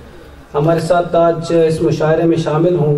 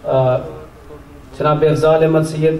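ordinary delivery

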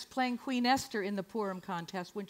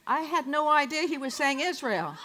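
ordinary talk, nothing marked

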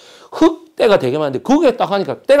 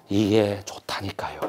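whispered, slow urging; hushed insistence; 9 semitones lower, 10 dB quieter than usual. Retold or imitated. To the chairman, a slow whispered plea. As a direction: lean in and whisper it's good.

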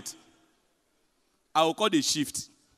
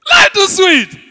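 much higher, loud "Ah" then life too sweet; joyful shout; 13 semitones higher, 14 dB louder, slower than usual. Real, not acted. Comedian celebrating to his audience, joyful loud shout. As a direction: crow it out to the crowd. Catchphrase cry to crowd.

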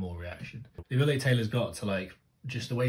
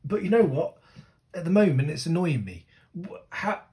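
exasperated; 8 semitones higher, 8 dB louder than usual. Real, not acted. Frustrated with the song's story.

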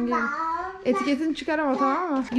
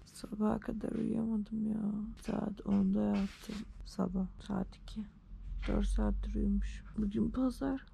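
whispering; 9 dB quieter, slower than usual. Real, not acted. Tired morning whisper.

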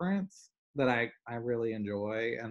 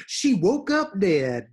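higher, louder, slow dad punchline; imitating dad; 7 semitones higher, 8 dB louder, slower than usual. Imitating her dad's deadpan gossip line, slow and louder. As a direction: drawl it out like dad's dramatic gossip. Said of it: "That's my favorite".

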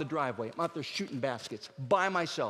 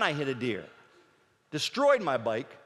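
ordinary delivery

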